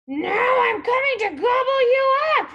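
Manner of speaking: high, loud troll shout; troll's roar. A narrator's reading. Troll charges at the biggest goat, roaring. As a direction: roar the threat out. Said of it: "roared the troll".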